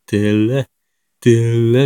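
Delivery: loud Nagoya dialect intensifier; 名古屋弁. Shown as a demonstration. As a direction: punch the word out in Nagoya dialect. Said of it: "もう、僕、どえら使う". (名古屋弁で強く言う)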